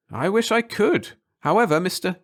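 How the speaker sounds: a lightly voiced scientist, hesitant; chemist's voice.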